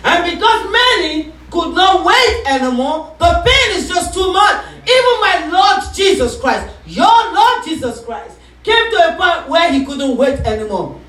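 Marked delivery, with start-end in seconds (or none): none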